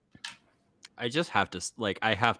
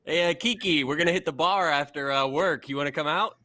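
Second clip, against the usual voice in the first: friendly coworker voice; 7 semitones higher than usual. Acted. NPC inviting to bar.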